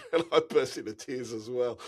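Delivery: real laugh while admitting tears. Real, not acted. Guide admitting he cried too, laughing.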